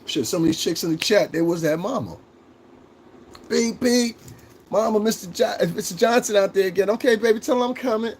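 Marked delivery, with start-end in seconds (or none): 3.5-4.2 high beep beep like a horn; imitated car horn. 4.7-6.9 put-on high kid's voice; a little kid telling mama; child voice. 6.9-8.1 high, sweet mom voice; mama's voice. Retold or imitated. Mama answers.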